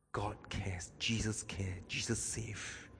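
soft, intimate whisper. Real, not acted. Preacher whispers comfort to the lonely. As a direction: whisper it to each lonely listener.

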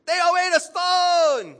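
very high, drawn-out full shout; shouted command. Acted. Jesus ordering the tomb opened, full-voiced shouted command. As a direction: bellow the order, stretching the words. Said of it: "Or Jesus say".

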